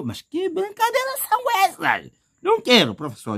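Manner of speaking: very high, squeaky outraged pupil; protesting student. Pretend. Student protesting the trick question, high and squeaky. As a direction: squeal the complaint like a fed-up kid.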